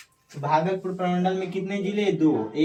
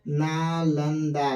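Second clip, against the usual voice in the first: slow drawn-out naming; much slower than usual. Shown as a demonstration. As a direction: say Nalanda very slowly and stretched. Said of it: "और छठा है नालंदा".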